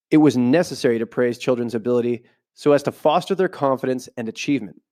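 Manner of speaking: ordinary delivery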